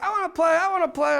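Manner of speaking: put-on high, loud, repeated child's plea; eager little boy. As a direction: beg to join like a small boy. Little brother begs to join.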